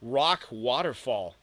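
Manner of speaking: slow, awestruck murmur; mumbled in awe. Acted. Girl staring at rolling rocks, mumbling in awe. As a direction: let the wonder slow the words down. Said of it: "she mumbled with awe".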